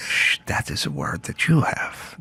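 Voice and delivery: soft hushing aside; playful hush. Pretend. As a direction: shush them conspiratorially. Hushing the joke.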